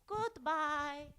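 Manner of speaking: high, drawn-out character goodbye; sing-song high farewell in a put-on voice; searching for character voice. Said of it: "I can't find it".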